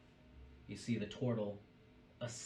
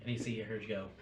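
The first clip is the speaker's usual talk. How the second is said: usual speaking voice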